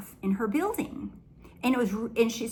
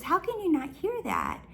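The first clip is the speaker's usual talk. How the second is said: retold incredulous question pitched much higher; imitated disbelief; 9 semitones higher than usual. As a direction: mimic the client, baffled that nobody hears it. Client baffled by unheard alarm.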